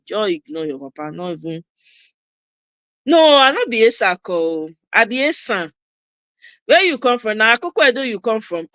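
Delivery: normal talking voice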